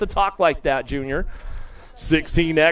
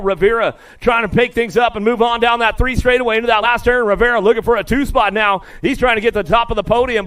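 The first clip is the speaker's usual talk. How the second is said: usual speaking voice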